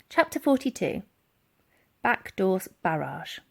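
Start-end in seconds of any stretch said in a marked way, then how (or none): none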